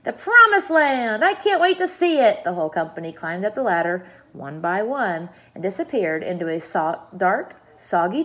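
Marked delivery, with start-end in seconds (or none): 0.0-2.4 loud, high-pitched jubilant yelling; whooping, high and loud; excited shouts. A narrator's reading. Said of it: "mounting excitement and shouts of".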